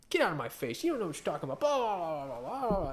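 mock back talk trailing into blah-blah; dismissive parody of a defensive retort; imitated mouthing off. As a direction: mimic someone mouthing off. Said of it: "they just wanna just mouth off".

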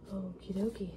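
ordinary talk

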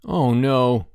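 drawn-out groan of dismay. A narrator's reading. Bruno watching the net fly away, groaning. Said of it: "groaned Bruno".

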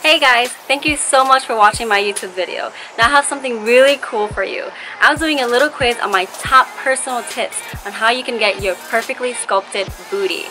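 loud, bright promo read. Acted. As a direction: pitch it like a sponsor spot. Recorded promo read, louder.

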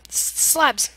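real relief; triumphant. Real, not acted. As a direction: blurt it out in relief. Finally remembers the name.

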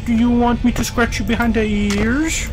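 high sing-song pet voice; baby talk to a dog. Pretend. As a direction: coo at it like a puppy. Talking to animatronic like pet.

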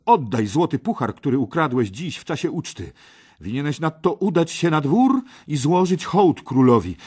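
high, loud, insistent demands; bold young Parsival. Acted. Parsival demands cup and armour, high and bold. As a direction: lay down terms with youthful defiance.

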